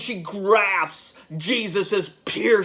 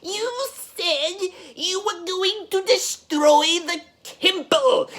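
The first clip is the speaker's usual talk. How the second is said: high-pitched heckling Pharisee; shouted jeer; 9 semitones higher than usual. Acted. Pharisee taunting Jesus over the temple boast, high shouted scorn. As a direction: call out a mocking challenge in a high voice. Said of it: "One of the Pharisees shouts".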